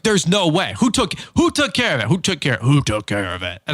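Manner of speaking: mock-furious demand to know who paid; blows a fuse. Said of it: "blows a fuse".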